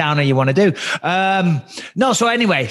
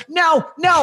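put-on whining kid; 11 semitones higher than usual. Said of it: "he's like".

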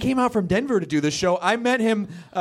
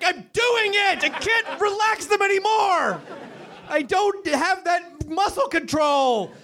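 high-pitched exasperated shouting; frustrated rant, straining upward; comically mad; 8 semitones higher than usual. Acted. Comic answering people who say relax your eyes, high exasperated rant. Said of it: "makes me so mad".

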